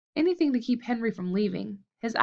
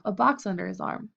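ordinary delivery

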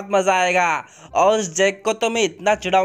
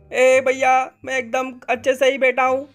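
higher, eager little-brother voice; high childlike sidekick voice; Munna's kid voice; 6 semitones higher than usual. Acted. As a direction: play the younger sidekick, pitched up. Munna nervous in the car.

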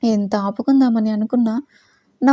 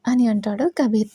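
plain reading voice

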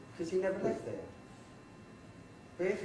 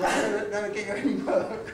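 laughing; 4 semitones higher, 3 dB louder than usual. Retold or imitated. Retold boss threat, laughing through.

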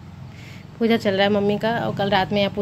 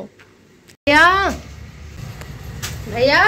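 calling out; 5 semitones higher, much slower than usual. Real, not acted. Calling for brother, a raised calling voice. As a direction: call out for someone to come.

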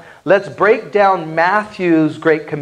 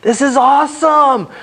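much higher, bright encouraging celebration; joyful congratulation; 8 semitones higher than usual. Shown as a demonstration. Evangelist congratulates someone who just prayed, high and joyful. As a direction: cheer on a new believer warmly. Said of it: "we can say".

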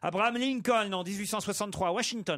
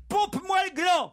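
high, loud cry from the back; a shouted high-pitched insult; pupil yelling; 13 semitones higher, 4 dB louder than usual. Acted. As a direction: yell it high at the teacher. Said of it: "se lève alors et crie".

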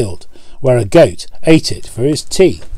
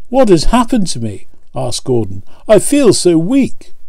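lightly voiced engine, higher, a touch of feeble dismay; weak Gordon; 6 semitones higher than usual. Gordon breaks down, feels weak.

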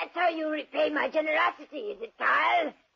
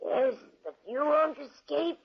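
ordinary talk

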